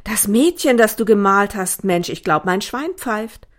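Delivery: loud, amazed outburst; astonished. A narrator's reading. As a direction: blurt it out in sheer disbelief. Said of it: "Mehr fiel ihm vor Überraschung". (laut und völlig verblüfft)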